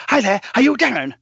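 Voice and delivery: high, loud, gushing hello; imitating an overexcited dog owner; overexcited greeting. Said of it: "And she said".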